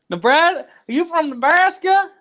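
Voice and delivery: loud, high-pitched excited questioning; excited shouting. Real, not acted. Host asking a commenter if they're from Nebraska, excited shout.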